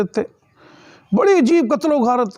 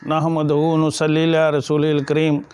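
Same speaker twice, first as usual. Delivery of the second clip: low, measured recitation on a level tone; Arabic opening invocation; 6 semitones lower than usual. Real, not acted. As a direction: intone the sermon's opening prayer, low and even. Opening Arabic invocation before the sermon.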